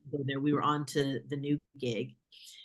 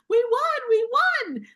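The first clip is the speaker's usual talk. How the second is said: imitating a higher, louder voice choked with happy tears; retold tearful joy; 9 dB louder, slower than usual. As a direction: play the director half-crying with joy, much higher and louder. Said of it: "like, weeping and barely, you know, understandable".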